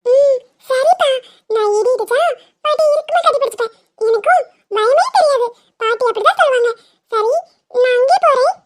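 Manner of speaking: put-on high-pitched child's voice; brave little child. Child telling father they will go to grandma, high childlike voice.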